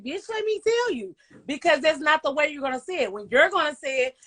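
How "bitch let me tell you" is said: sassy bitch. Retold or imitated. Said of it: "you're not gonna come off and be like".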